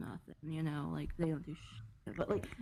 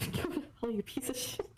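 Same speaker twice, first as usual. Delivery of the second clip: laughing while repeating it; 5 semitones higher than usual. Real, not acted. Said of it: "Oh my gosh".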